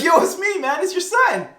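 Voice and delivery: high, loud disbelieving protest; indignant shout. Retold or imitated. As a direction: act out a son yelling to be recognized. Esau protesting that it's him.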